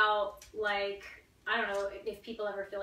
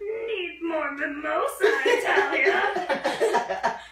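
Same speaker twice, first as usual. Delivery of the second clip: slow, drawn-out monster growl; 6 semitones higher, 7 dB louder, much slower than usual. Pretend. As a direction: stretch it out like a lumbering monster. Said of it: "Could you do your monster voice".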